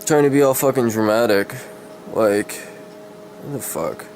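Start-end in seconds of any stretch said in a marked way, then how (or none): none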